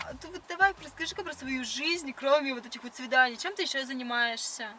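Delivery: ordinary talk, nothing marked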